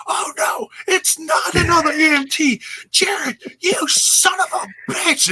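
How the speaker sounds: frantic high-pitched commentator yelling, voice cracking; Mike Tenay impression. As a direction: lose your mind on the mic like a stunned announcer. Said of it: "Oh, good impersonation".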